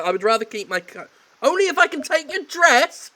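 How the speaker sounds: fast, then loud and high; smarmy flirt; cheeky hero. Acted. Heir flirting with the maid, cheeky.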